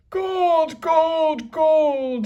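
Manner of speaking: loud, high gleeful chant; greedy cry. A narrator's reading. Captain gloating over gold, loud gleeful cry. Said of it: "he cried".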